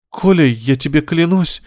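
high, slow, wounded oath; hurt pleading. A narrator's reading. Hurt wife swears innocence to her husband, pleading. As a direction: plead slowly, close to tears. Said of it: "Из глаз Маши потекли слезы обиды".

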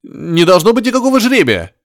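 high squeal still breaking; shrill cracking voice. A narrator's reading. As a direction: keep squealing, near hysteria. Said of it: "взвизгнул каким-то срывающимся голосом".

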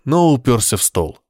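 ordinary delivery

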